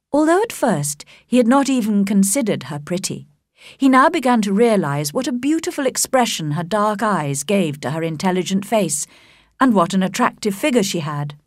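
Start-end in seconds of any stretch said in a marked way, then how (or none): none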